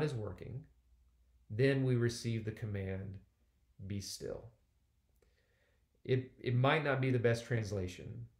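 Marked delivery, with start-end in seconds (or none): 3.9-4.5 gentle be still. Shown as a demonstration. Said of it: "more emphatic than, "Be still".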